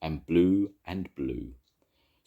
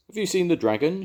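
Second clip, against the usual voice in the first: high, loud call to the policeman; eager shout; 7 dB louder than usual. A narrator's reading. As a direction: shout it out across the platform. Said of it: "shouted Jones".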